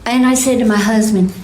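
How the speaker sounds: usual speaking voice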